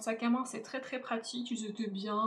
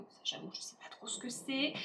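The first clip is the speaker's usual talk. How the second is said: whispered aside; 3 semitones higher, 6 dB quieter than usual. Real, not acted. Confiding aside about unknown ingredient.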